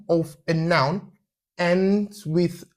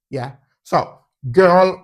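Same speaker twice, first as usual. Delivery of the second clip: ordinary delivery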